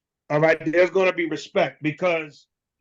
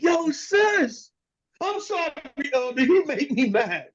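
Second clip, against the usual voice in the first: mock yell; 10 semitones higher than usual. Retold outburst and apology.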